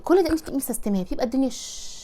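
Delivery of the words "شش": soft drawn-out shush; long quiet "shh"; hushing shush. Shown as a demonstration. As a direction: hush the whole house. Shushing to show a quiet house.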